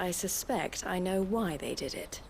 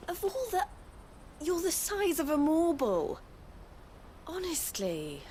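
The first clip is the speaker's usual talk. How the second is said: high, exasperated outburst that sinks to a sigh; exasperated scolding; 10 semitones higher than usual. Acted. El scolding little Goetz, exasperated and high. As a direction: throw up your hands at him.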